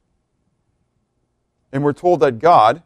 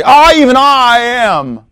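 "I, even I am" loud, high and drawn out; emphatic declaration; 9 semitones higher, 6 dB louder, slower than usual. Shown as a demonstration. Teacher showing the Greek emphasis, "I, even I am" said emphatically. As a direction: proclaim "I, even I am", stretching each "I" at raised pitch. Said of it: "saying it emphatically".

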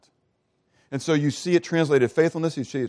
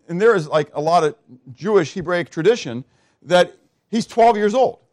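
ordinary talk

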